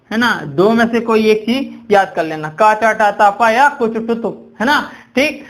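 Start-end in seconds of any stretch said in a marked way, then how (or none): none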